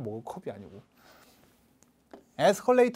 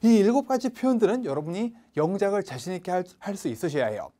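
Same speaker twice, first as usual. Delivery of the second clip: usual speaking voice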